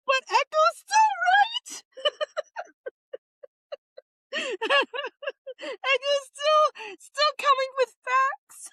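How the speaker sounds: very high, giggling through it; cracking up at chat; laughing. Real, not acted. Streamer laughing at Echo's comment, high and giggly.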